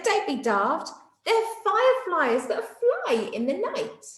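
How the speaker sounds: high, giggling retort; laughing. Acted. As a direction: laugh it off at a silly friend. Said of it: "Night Monkey laughed and said".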